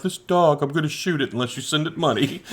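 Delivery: a touch of a somber ad narrator; charity ad voice. Retold or imitated. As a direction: parody a sad charity appeal. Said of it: "[name] commercials where it's like".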